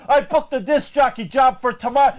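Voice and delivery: ordinary talk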